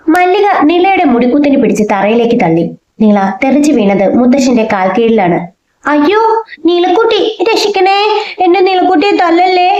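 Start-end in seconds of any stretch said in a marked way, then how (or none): 5.9-9.7 high wailing cry for help; wailing plea. A narrator's reading. Vinayan wails as his sister is pushed to the floor. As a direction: beg them to stop, voice way up in panic. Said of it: "Vinayan അലമുറയിട്ട് കരഞ്ഞു".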